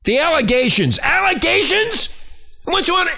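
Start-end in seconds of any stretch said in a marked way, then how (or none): 1.0-2.2 high, slow, disbelieving question; incredulous. Real, not acted. Throws the word back incredulously. 2.7-3.1 high-pitched, exasperated rant; angry yelling. Real, not acted. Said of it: "I'm sorry I'm yelling".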